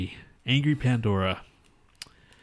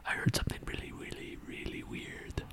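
creepy Mexican whisper; 6 dB quieter than usual. Pretend. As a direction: draw out the Mexican-accented whisper. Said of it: "creepy Mexican whisper boy".

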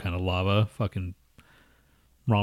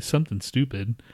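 usual speaking voice

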